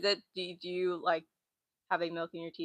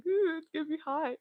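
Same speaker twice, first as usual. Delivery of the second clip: mock baby; 10 semitones higher than usual. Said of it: "I was like, I was being a baby".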